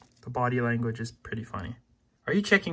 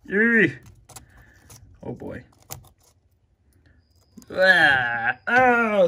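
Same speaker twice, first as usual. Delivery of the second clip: loud, sudden high exclamation; shocked loud gasp; startled disgust; 6 semitones higher, 10 dB louder, much slower than usual. Real, not acted. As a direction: react to the gore. Lizard makes a gory mess.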